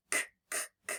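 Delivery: k, k, k as bare breathy clicks with no voice; demonstrating voiceless k; unvoiced k. Shown as a demonstration. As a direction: whisper the k, k, k sound. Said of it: "is that a voice sound?".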